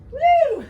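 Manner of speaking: very high, loud whoop; excited whoop. Real, not acted. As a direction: shout for joy. Trainer cheering fifty push-ups done.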